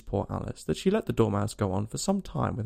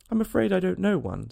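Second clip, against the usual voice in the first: high, slightly worried reply; mildly alarmed; 7 semitones higher than usual. A narrator's reading. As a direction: back away from the proposal, a touch alarmed. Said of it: "rather alarmed at the proposal".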